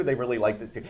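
ordinary talk, nothing marked

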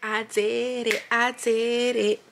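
high, slow disbelieving joy; stunned happy squeal; excited squeal. Real, not acted. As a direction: let the joy burst out, drawn out. Joy after seeing the ROI result.

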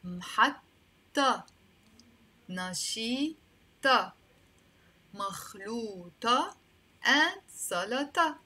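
محطة، نشيطة، مخلوطة، سلطة drawn out, ending in "tah"; teacher modelling محطة، نشيطة، مخلوطة، سلطة with "ah" endings; final "ta" sound. Shown as a demonstration. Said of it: "Let's see how we will pronounce them".

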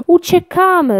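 high, loud panicked shout; panicked roar. A narrator's reading. Lion panics at the monster, loud high panicked shout. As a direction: yell it as you grab the oars in panic. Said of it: "ryknął Lucjan".

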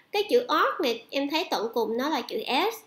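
usual speaking voice

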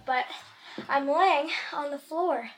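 ordinary delivery

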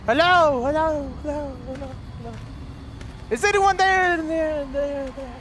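calls fading into echo; calling with echo. Real, not acted. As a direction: call into a dark cave.

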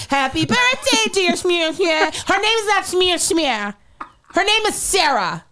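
high-pitched, indignant mom imitation; mocking scolding. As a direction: mimic the botched song, then correct it high and indignant. Mocks child's singing, then acted scolding.

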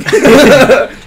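loud high laugh bursting out; big real laugh; burst of laughter. Real, not acted. Laughing at own joke.

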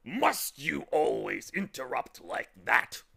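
loud, slow, exasperated scolding; irritable. A narrator's reading. Tock losing patience with the bird, loud and irritable. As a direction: scold the interrupter, slowly and loudly. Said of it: "said Tock irritably".

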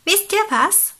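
high, loud, eager proposal; pelican with an idea. Acted. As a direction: announce a bright idea as the pelican.

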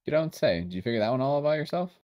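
sarcastic. Real, not acted. Sarcastic jab at reader.

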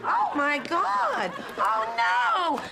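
slow, high horrified gasp; alarmed. Acted. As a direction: drag it out in shock. Finds the dog seemingly dead.

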